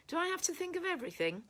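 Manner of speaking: high, quick mock exasperation; amused exasperation. A narrator's reading. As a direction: toss it off fast and high, teasing. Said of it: "said Yulia with a laugh".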